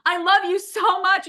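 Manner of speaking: high, pleading lovesick groom; hammed-up adoring husband-to-be. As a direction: gush it up high like a clingy groom. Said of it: "He's going".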